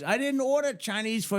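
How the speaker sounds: high-pitched mock protest; retold exasperation; exasperated denial. Called man denies it once more.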